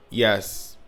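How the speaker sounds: ordinary delivery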